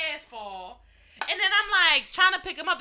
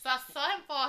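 normal talking voice

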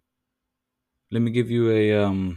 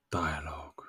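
whispered echo; 4 semitones lower, 9 dB quieter than usual. Acted. Whispered echo of topic word.

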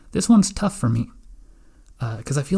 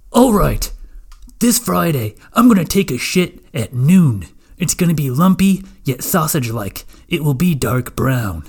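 excited announcement; 2 semitones higher, 6 dB louder than usual. Acted. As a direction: announce it like a big goal. Future poop plan, loud.

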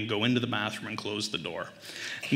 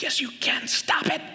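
high, strained frantic retort; exasperated dad. Acted. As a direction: snap back in hammed-up desperation. Exasperated dad answering toddler.

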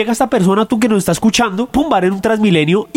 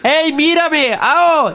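pretend shout; 7 semitones higher, much slower than usual. Yelling at neighbours from window.

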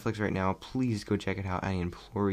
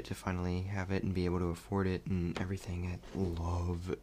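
ordinary talk

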